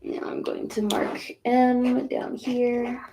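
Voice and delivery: high, slow put-on silly voice; goofy silly voice. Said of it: "I like to act goofy like that".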